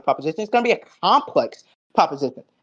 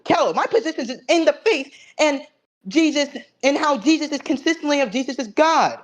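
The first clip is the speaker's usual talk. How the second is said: higher, laughing mock of his rival's words; scoffing impression of the other debater; mocking imitation of opponent; 7 semitones higher than usual. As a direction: parrot the opponent with a sneering laugh.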